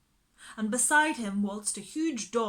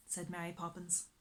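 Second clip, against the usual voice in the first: plain reading voice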